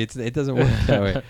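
usual speaking voice

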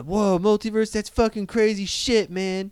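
put-on high-pitched foul-mouthed kid, amazed; pretend eight-year-old nephew. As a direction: play a cussing little kid having his mind blown. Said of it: "as if I'm an eight-year-old nephew".

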